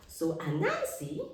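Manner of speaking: ordinary delivery, nothing marked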